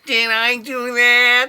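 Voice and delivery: high, slow nerdy squeak; imitating Urkel's nasal catchphrase. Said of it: "the actor that played Urkel".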